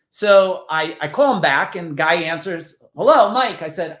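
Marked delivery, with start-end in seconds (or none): 3.0-3.6 loud, higher hello; imitated phone greeting. Guy answers the call back, imitated hearty greeting. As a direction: answer big, imitating the man on the line.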